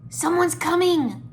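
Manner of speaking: loud, high, drawn-out warning; urgent stage whisper. A narrator's reading. Jay alerts the ninja to approaching guards, urgent warning. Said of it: "Jay whispered".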